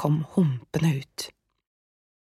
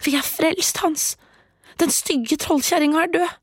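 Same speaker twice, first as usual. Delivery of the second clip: joyful shout; 11 semitones higher than usual. Acted. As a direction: cry out the good news.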